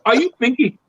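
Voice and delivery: put-on rapid-fire repeats in an Indian accent; flustered fan in a mock Indian accent; imitated Indian accent. Fan recognizes him at machine, imitated Indian accent. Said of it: "And this Indian guy".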